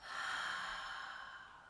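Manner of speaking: long breathy sigh out through the mouth; audible cleansing out-breath; long exhale sigh. Shown as a demonstration. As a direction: let a full breath go. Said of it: "and out through the mouth".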